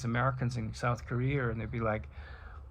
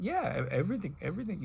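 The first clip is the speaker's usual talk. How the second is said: put-on silly Indian accent; 6 semitones higher, faster than usual. Sufi mystic answering the troubadour, silly Indian accent. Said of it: "I'm doing a silly Indian accent".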